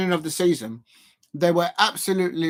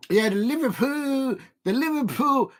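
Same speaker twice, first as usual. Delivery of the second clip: mock Scouse accent; 7 semitones higher than usual. Commentator teasing Liverpool fans, in a mock Scouse accent. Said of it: "I'm doing a better accent than the Liverpool".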